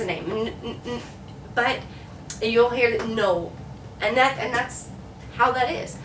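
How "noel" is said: "no" for Noel, run into one syllable NOLE; one sound. Shown as a demonstration. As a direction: say "no" as NOLE, without a break. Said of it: "that being one sound".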